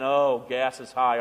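low, slow mock grumble; mock complaining. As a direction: imitate someone moaning about prices. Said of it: "Stop complaining".

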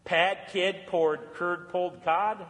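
very slow, word by word; careful tongue twister. Shown as a demonstration. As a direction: pick through each word of a tongue twister slowly.

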